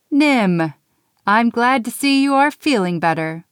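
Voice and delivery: put-on gently pleased old Santa; lightly voiced Santa. A narrator's reading. Santa greeting Nim, lightly voiced and kind.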